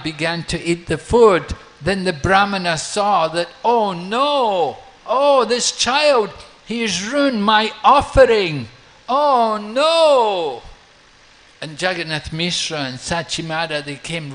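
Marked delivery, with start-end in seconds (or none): 3.6-10.6 high, loud, dismayed wail of "oh no"; a distraught priest lamenting, loud and high; dismayed cry. Acted. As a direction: cry out as if your offering is ruined. Brahmana sees his offering ruined.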